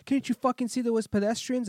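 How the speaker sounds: high, loud acted scolding; pissed-off driver. Angry driver scolding him in English, acted fury. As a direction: berate him as an irate driver. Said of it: "he's pissed off".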